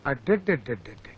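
low, soft stuttering babble; a lost father stammering to himself, dazed; bewildered stammer. Acted. Dumbfounded after the fight.

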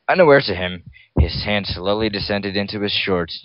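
usual speaking voice

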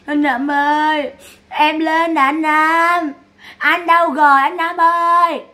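high, long-drawn imitation of wailing; mock graveside wail. Imitating Sáu calling at her brother's grave, mock wail. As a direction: stretch each call out in a hammed-up lament. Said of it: "Sáu này chạy Sáu khóc quá khóc luôn".

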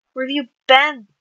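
loud, high angry demand; shouted. A narrator's reading. Mad brother confronting Tommy at the door, shouting. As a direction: shout the question accusingly. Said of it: "Wilbur shouted".

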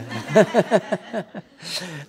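hearty, loud burst of laughter. Real, not acted. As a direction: laugh out loud, high and rolling. Reacts to a big question.

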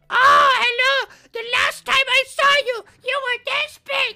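high, loud, gushing aunt voice; squealing baby talk aunt. Retold or imitated. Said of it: "I got the stereotypical".

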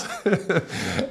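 soft laugh. Real, not acted. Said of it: "crying and laughing".

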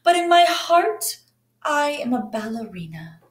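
high, earnest declaration; proud dinosaur ballerina. Acted. Bronterina tells the dance teacher her dream, high and earnest.

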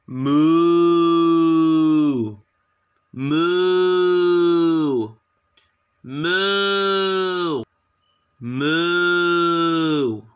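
long loud cow moo. Pretend. Kids playing Simon Says, loud drawn-out cow moo. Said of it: "Moo like a cow".

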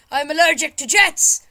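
high, loud panicked yell; mock panic. Player protests at the jets hitting him, mock-panicked yell. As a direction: cry out in hammed-up alarm.

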